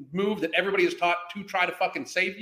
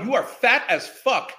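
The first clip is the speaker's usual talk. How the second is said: normal talking voice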